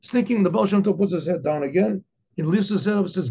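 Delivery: ordinary delivery, nothing marked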